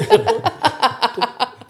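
high burst of laughter; snorting laughs. Real, not acted. Loud laugh after snort.

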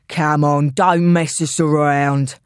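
put-on low, coarse old-style London voice; slow, gruff old-time London accent. Intruder warning someone hiding, coarse London accent. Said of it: "with an old-style London accent, gruff and coarse".

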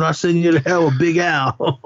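high-pitched retold threat; mock angry threat.

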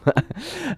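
short high laugh; amused laugh. Real, not acted. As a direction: laugh off the hiccups. Laughing at own hiccups on podcast.

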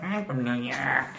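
mocking bad New York accent; mocked New York accent. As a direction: mimic someone's terrible New York accent, drawn out. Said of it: "You were like".

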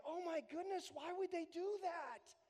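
high, shocked mock dismay; mock scandalized. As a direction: imitate a scandalized believer. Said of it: "the idea is like".

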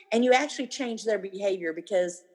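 ordinary delivery

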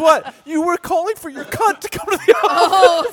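high, loud, laughing disbelief; hammed-up shocked exclamation; incredulous outburst. Retelling the astonished exchange in hallway.

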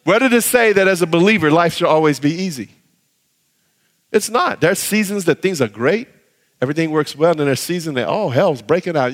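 normal talking voice

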